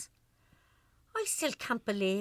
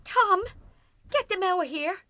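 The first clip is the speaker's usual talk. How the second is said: high, loud frantic plea; panicked plea; 6 semitones higher, 7 dB louder than usual. Acted. Wife begging husband to remove the bailiffs, panicked. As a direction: beg him to throw them out.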